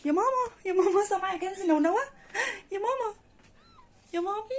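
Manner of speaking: high, loud, delighted calls of mama; excited squeal. Real, not acted. Owner thrilled to see the newborn kittens, squealing. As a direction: gasp it out, thrilled by what you see.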